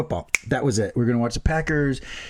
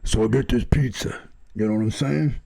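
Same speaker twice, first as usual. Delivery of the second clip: lower, gruff storyteller bit; put-on tough guy voice; 3 semitones lower than usual. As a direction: switch into a deliberately different voice to tease. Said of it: "let me talk like this then".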